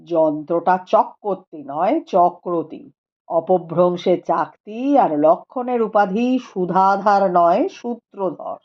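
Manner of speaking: a calm scholar speaking gravely; Rupendra's voice. A narrator's reading. (নিচু গম্ভীর গলায় পণ্ডিত রূপেন্দ্র)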